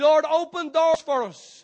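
slow, high, loud cry; fervent slow prayer shout. Acted. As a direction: stretch out each word, shouting. Call and response prayer.

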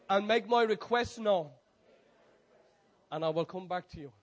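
slow, loud, measured declaration; prayer declaration. Acted. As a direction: declare it phrase by phrase for all to repeat.

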